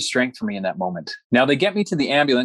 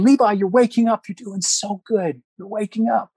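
much higher, bright encouraging call to a waking patient; wife calling out; 10 semitones higher than usual. Retold or imitated. Wife calling to her husband as he wakes, much higher and encouraging. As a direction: voice the wife coaxing him awake. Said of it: "hearing this voice calling out".

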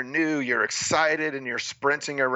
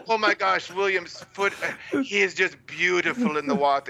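higher, mock-gushing commentator; gushing announcer; 5 semitones higher than usual. Mocking the TV commentary of his race, a higher gushing announcer. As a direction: lightly ham up a broadcaster raving over the star. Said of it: "So the announcer's like".

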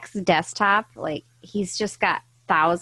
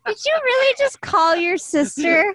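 surprised; 12 semitones higher than usual. Real, not acted. Disbelief at call during podcast.

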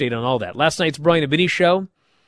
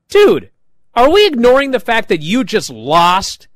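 incredulous; 7 semitones higher, 6 dB louder than usual. Retold or imitated. Teammate confronts loser.

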